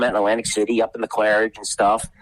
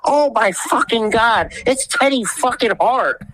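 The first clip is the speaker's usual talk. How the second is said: much higher, slow, stunned announcer cry; shocked commentator; slower than usual. Retold or imitated. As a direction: imitate a stunned ringside commentator. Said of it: "all you hear is".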